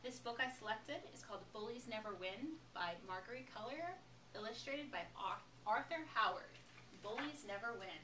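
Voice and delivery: ordinary delivery, nothing marked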